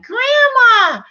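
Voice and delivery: put-on squealing high child's Grandma; a granddaughter greeting her grandmother with delight, squealed high; enthusiastic child. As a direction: call Grandma like an excited little girl. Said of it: "she said it with such enthusiasm".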